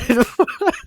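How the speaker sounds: high, loud burst of laughter; disbelieving laugh. Real, not acted. Storyteller recalling the grisly detail, disbelieving laugh. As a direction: crack up at the absurdity.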